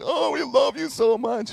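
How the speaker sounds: high, gushing relief; relieved gush. Retold or imitated. Parents greeting their found son, high gushing relief. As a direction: pour out the relief all at once. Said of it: "We're like".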